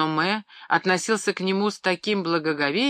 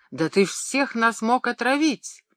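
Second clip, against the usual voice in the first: higher horrified exclamation; alarmed cry; 7 semitones higher than usual. A narrator's reading. Wife scolding the boy in horror, alarmed cry. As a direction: terror at what might have happened. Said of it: "воскликнула госпожа Оме".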